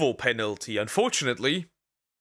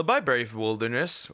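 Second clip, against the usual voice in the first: usual speaking voice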